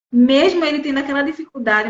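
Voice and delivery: ordinary talk, nothing marked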